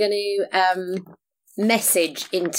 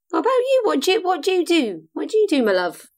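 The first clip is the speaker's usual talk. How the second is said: sweet high voice; 10 semitones higher, faster than usual. Acted. Voiced character greets boy.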